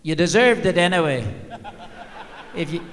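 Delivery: lightly put-on Irish accent of a wife. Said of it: "said in this Irish accent".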